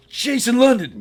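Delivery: very high, loud thrilled call of a name; excited shout. Retold or imitated. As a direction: belt the name out in delight. Tarantino spotting the speaker.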